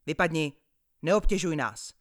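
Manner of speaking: put-on loud, cross snap at the boy; angry roar. Annoyed man yelling at the paperboy, loud. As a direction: drive the pest away, loudly. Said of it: "zařval na mě".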